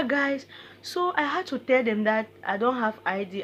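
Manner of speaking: ordinary talk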